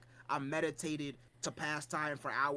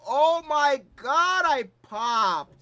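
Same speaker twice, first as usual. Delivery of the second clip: excited; 7 semitones higher, 10 dB louder, slower than usual. Real, not acted. Excited about favourite anime.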